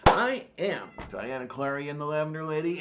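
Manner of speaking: ordinary talk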